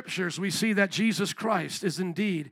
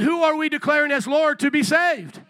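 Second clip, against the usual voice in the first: loud, higher-pitched preacher's call; raised-voice rallying question; shout it out; 5 semitones higher, 5 dB louder than usual. Real, not acted. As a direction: repeat it forcefully to rouse the congregation. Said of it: "come on, shout it out".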